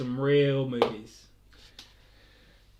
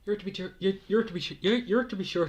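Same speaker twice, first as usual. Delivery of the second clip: higher-pitched, faltering go at Irish; bad Irish accent; 6 semitones higher than usual. Streamer failing at accents for viewers, bad Irish accent. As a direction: stumble through a poor Irish accent. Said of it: "I can't do accents".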